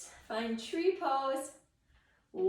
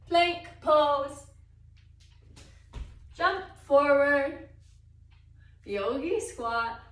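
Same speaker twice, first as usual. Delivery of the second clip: usual speaking voice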